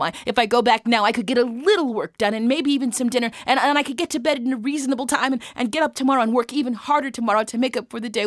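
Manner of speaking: agitated thinking aloud; self-doubting ramble. Acted. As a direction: talk yourself out of it in a hurry. Isaac doubts himself, argues to go back.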